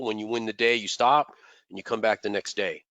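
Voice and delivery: normal talking voice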